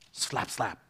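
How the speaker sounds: low, soft mock slap slap; lightly voiced sting of a rebuke; quiet mock slap sound. Mock sound after terse reply.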